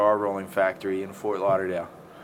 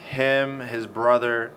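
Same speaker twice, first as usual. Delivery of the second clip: ordinary talk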